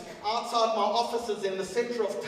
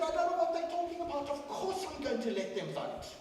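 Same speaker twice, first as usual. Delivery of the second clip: higher, fast protest of innocence; innocent farmer; 8 semitones higher, 4 dB quieter than usual. Retold or imitated. Farmer to investigators, feigning innocence, higher and fast. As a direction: play the surprised farmer.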